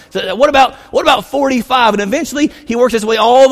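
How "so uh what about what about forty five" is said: higher and louder, pushing the offer; Abraham haggling with God, loud and eager; bargaining shout. Retold or imitated.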